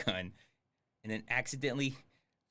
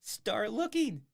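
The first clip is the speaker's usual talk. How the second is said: a light mock of the boss, much higher, drawn out slow; 9 semitones higher, slower than usual. Streamer mocks Seagal's search order, mocking imitation. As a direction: parrot the boss's dumb order back, mocking. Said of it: "That's how he wants to catch the killer".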